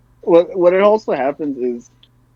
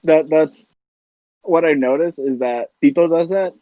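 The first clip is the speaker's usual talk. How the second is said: normal talking voice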